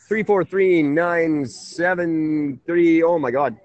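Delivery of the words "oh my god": ordinary delivery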